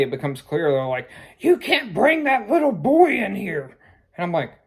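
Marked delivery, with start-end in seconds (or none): 1.4-3.8 high, loud store clerk yelling a warning; shouting clerks. Retold or imitated. Liquor store staff shouting at a parent walking in, loud and high. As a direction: call out sharply from across the store. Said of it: "starts shouting at me".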